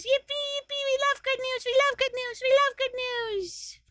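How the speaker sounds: very high celebratory chant; squeaky cheer. Pretend. Cheering a viewer's good news.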